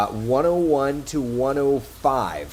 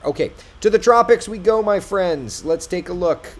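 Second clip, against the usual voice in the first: big bright jump into the tropics; excited; 7 semitones higher, 10 dB louder than usual. Real, not acted. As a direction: launch it with high energy, much louder.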